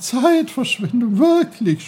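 a stream taking offense; indignant brook. Acted. The brook protests Peter calling its work a waste. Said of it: "sprudelte der Bach entrüstet".

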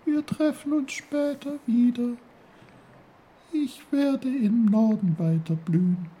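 a tiny snowdrop speaking gently. Acted. The snowdrop answers Uncle Pfefferkorn in a high little voice.

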